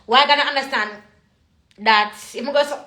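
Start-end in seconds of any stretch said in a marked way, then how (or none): none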